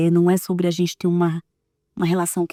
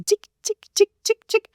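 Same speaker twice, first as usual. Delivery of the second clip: high ticking sounds; 13 semitones higher than usual. Retold or imitated. Mother to her psychiatrist, imitating her son's tics in a high voice. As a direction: mimic a ticking tic, much higher. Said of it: "ele pode dar assim".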